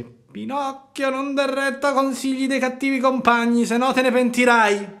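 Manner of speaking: a little bird calling a warning from the hedge; warning blackbird. A narrator's reading. (il merlo che ammonisce, voce molto più alta e lenta all'inizio)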